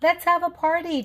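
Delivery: cheerful. A narrator's reading. Chutney cheerfully suggests.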